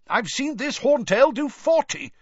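high, loud voice of a keeper straining on a chain; straining dragon keeper. Acted. Keeper warning Hagrid while straining on the chain, loud and high. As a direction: call it out while hauling on the chain.

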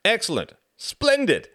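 high, loud and jolly; hearty exclamation. Acted. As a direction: burst out with delight.

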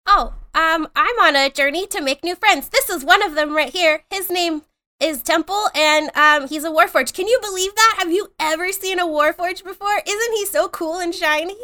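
high, excited little kobold voice; eager innocent kobold. Acted. Pants showing off his Warforged friend, high and excited. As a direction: gush proudly in a high voice.

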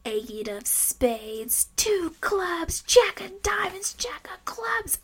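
eerie slow whisper rising high; creepy possessed card calling. Retold or imitated. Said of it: "Cheryl suddenly begins to call out the cards".

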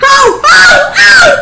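rising, thrilled cries of oh; excited shout. Real, not acted. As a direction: yell out in delight at the roll. Dice roll lands big.

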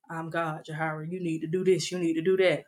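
voice of God. Retold or imitated. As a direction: speak softly as God calling her by name. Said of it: "so God talks like that. This how he sound when he talk".

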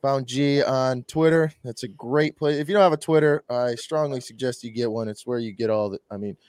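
ordinary talk, nothing marked

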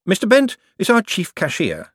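high, eager banker politeness; Moist, polite banker. A narrator's reading. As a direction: keep the customer sweet. Moist speaks.

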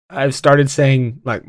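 usual speaking voice

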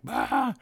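high, loud squeal of delight; starstruck squeal. Retold or imitated. Audience seeing Walt at the gate, high squeal. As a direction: let out a fan's squeal. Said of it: "we're like".

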